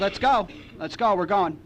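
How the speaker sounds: hustle him out the door; urgent hurry. Retold or imitated. Sponsor knocks and hurries Chris out, high and pushing.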